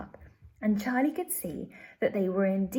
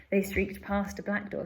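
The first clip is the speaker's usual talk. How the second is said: ordinary delivery, nothing marked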